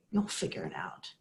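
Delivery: low, soft fatherly murmur; retold hushed comfort; reassuring father. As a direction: drop low and quiet to reassure her. Dying father reassures crying daughter.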